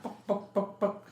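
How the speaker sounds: high clucking chicken noises. Retold or imitated. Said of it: "the chicken comes back".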